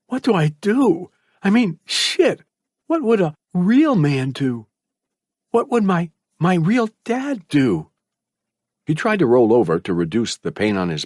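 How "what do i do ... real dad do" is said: put-on high young voice, lost; desperate whisper. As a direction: ask yourself in despair. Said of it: "Malcolm whispered".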